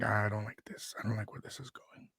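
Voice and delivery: hushed uneasy whisper under the breath; a wary aside whispered to himself; worried whisper. Retold or imitated. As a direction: whisper it as if she might hear. Retold inner worry.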